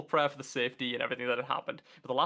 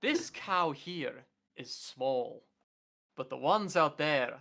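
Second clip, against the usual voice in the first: very slow, patient explanation; Father Ted reference; much slower than usual. Acted. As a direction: spell it out slowly, as if to a dimwit. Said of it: "don't get that reference".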